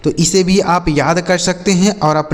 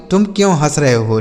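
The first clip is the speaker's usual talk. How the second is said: normal talking voice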